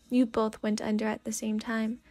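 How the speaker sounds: plain reading voice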